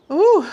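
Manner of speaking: high, loud ooh; delighted ooh. Acted. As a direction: cheer them onto the summit. Reaching the top of block.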